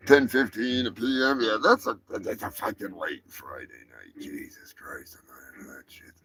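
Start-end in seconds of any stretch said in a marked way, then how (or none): 2.1-6.1 low whisper, muttered to self; whispered aside. Real, not acted. Host grumbling aside about the late show time, whispered. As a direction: hush it like a private grumble.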